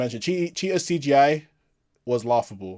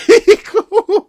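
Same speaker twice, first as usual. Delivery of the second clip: high burst of real laughter; loud laugh; 9 dB louder than usual. Real, not acted. Reviewer bursting out laughing at the film's praise. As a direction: crack up out loud.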